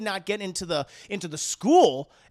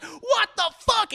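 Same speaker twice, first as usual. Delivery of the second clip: much higher, slow disbelieving cry; high outraged yelp; 5 dB louder, slower than usual. Retold or imitated. Coach Cotton reacting to the penalty, high outraged cry. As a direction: squawk in disbelief.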